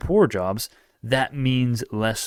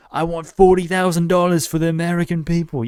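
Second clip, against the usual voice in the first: high, loud mock Bernie Sanders accent; clumsy Bernie Sanders impersonation, raised and loud; 7 semitones higher, 5 dB louder than usual. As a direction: badly attempt a politician's accent, pitched up and pushed out. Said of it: "I can't do an accent".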